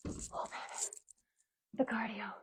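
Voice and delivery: breathless whisper; gasping for air after squats; out of breath. Real, not acted. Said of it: "I'm kind of out of breath".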